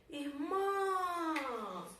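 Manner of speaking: irmÃS with the last syllable held long and high; stressed syllable drawn out. Shown as a demonstration. Teacher hunting the tonic syllable, stress drawn out. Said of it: "A sílaba tônica dela é a última".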